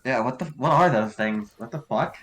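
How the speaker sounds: ordinary talk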